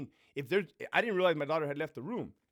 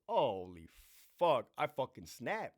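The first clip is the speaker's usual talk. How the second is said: ordinary delivery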